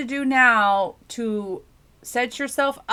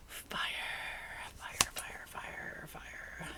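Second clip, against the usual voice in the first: whispered fire; 17 dB quieter, much slower than usual. Real, not acted. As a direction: whisper the word fire, hushed and drawn. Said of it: "whispering fire".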